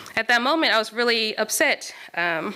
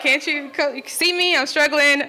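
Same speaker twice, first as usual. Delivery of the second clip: higher and louder mock cry for help; exasperated plea; 6 semitones higher, 4 dB louder than usual. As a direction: plead with the instructor who won't look back. Silent plea to instructor underwater.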